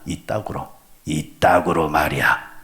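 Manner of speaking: 이따구로 in slow Gyeongsang dialect, lightly voiced. Shown as a demonstration. Pastor showing how Gyeongsang people say it, slow Gyeongsang dialect. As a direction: say 이따구로 말이야 slowly, the Gyeongsang way. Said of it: "경상도 사람들은 이따구로 합니다".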